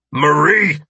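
high, loud cry of alarm; calling out. Acted. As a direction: call out to someone in danger. Man calls out to endangered woman.

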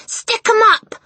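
high, loud commanding yell. A narrator's reading. Matilda bursts in with knife, yelling. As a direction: shout it as if cornering a burglar. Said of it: "she yelled".

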